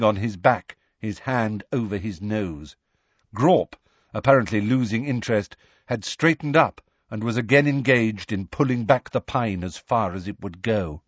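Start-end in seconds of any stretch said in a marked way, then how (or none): none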